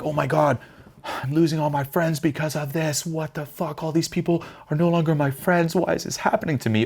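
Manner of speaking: high, whiny panic; panicked self-pity. Pretend. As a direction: wail as friends desert you. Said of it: "You could view it like".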